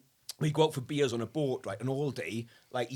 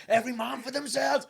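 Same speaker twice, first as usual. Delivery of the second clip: higher, half-shouted imitation of a battle cry; mock battle cry; 9 semitones higher, 4 dB louder than usual. Retelling friend's window leap, half-hearted mock battle cry. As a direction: lightly mimic a man yelling before jumping.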